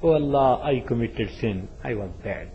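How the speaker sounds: low, soft, sinking penitent voice; ashamed confession. Retold or imitated. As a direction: confess in shame, fading to very quiet. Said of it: "he feels ashamed".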